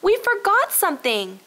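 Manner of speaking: high, loud sudden alarm; urgent cry. A narrator's reading. Robbie reads the grocery list and cries out urgently. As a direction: stop everyone short. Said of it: "suddenly cried out".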